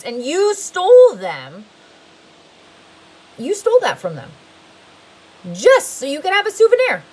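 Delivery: a judge thundering blame down at him; stern judge rebuke. A narrator's reading. Judge condemning Stanley in court, loud rising rebuke.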